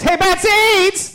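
high, drawn-out hollering caricature. Acted. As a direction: holler it slowly in a silly high voice. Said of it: "that's how he talks in my head".

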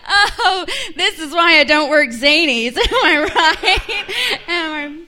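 laughing. Real, not acted. Laughing at heckle.

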